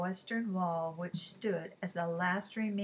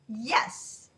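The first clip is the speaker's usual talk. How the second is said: sharp exclamation; 3 semitones higher, 6 dB louder than usual. Acted. Angry reply to the waiter.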